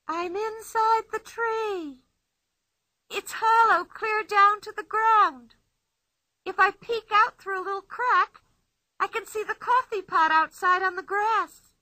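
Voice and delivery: put-on playful girl boasting from inside the tree; Pippi's voice. A narrator's reading.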